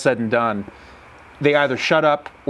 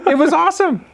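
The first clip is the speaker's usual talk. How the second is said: high, loud, real excitement; thrilled exclamation; 5 dB louder than usual. Real, not acted. Hunter agreeing it was his best day, thrilled shout. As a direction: burst out with delight.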